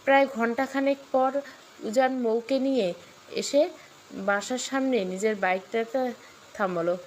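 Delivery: usual speaking voice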